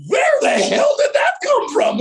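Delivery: high, loud astonished yell; floored, hollering onlooker; amazed shout. Retold or imitated. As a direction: shout in disbelief from the sidelines. Amazed parent on sidelines.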